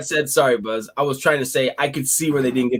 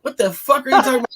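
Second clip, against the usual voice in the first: higher, fast incredulous yell; retold yell; 9 semitones higher, faster than usual. Speaker answering the threatening woman, fast high yell. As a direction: fire it back fast in disbelief. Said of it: "And I'm like".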